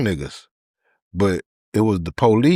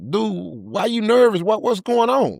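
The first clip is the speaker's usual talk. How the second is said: suspicious pressing; 11 semitones higher than usual. Retold or imitated. Pressing the nervous dude.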